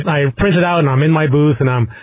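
ordinary talk

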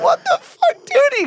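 very high squeaky disbelief; high-pitched retold disgust; shocked disbelief. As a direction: replay the moment of pure shock. Retold reaction to gross story.

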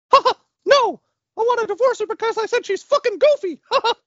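very high squeaky falsetto; loud cartoon mouse, laughing as he protests; Mickey Mouse. Correcting the divorce lawyer, shrieky high mouse voice. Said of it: "So Mickey Mouse is sitting".

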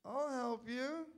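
slow, teasing sing-song; mocking drawl. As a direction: drag it out to poke fun. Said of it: "And then he goes like this".

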